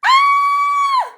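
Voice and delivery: shrill high shriek of fright; scream. Acted. Girl screams as the wolf pounces, high piercing shriek. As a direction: scream in terror.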